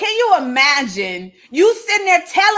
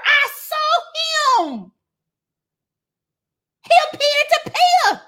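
high, rising, breathless disbelief, building higher to the end; excited disciples; 5 semitones higher than usual. Acted. Disciples telling the others Jesus is risen, getting higher and more excited. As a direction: burst in with news nobody will believe.

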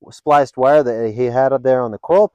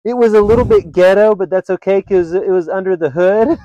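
higher, louder amused echo of his line; imitating Allison; 7 semitones higher, 6 dB louder than usual. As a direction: parrot his words back in a giggly friend voice. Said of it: "And she goes".

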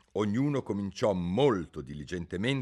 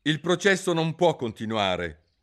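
stately royal proclamation; a grand decree from the throne; solemn king; 9 semitones higher, 8 dB louder than usual. A narrator's reading. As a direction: pronounce it like a pompous king. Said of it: "proclamò solennemente il re".

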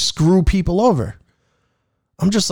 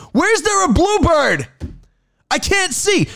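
bewildered; 12 semitones higher, slower than usual. Retold or imitated. Mock searching, bewildered.

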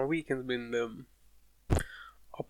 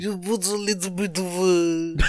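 Bill Cosby impression; 5 semitones higher than usual. Comic doing Bill Cosby to take the piss, hammed-up impression. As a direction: do a mock Cosby, raised and overblown. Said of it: "doing the Bill Cosby accent".

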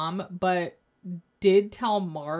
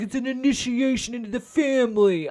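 mock bro voice; 4 semitones higher, 5 dB louder than usual. Mocking Joe Gorga's bro talk.

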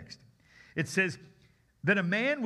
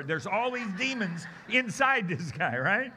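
normal talking voice

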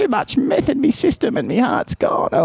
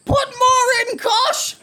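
much higher, half-sobbed yelling; crying shout; 14 semitones higher, slower than usual. Acted. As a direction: plead through pain, shouting. Patient begs during surgery.

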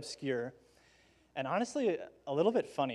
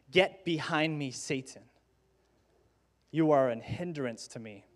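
measured, deliberate rebuke; unrestrained rebuke. Acted. Jesus responding to Peter, sternly rebuking him. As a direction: rebuke firmly without raising the voice much. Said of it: "doesn't hold back in his response".

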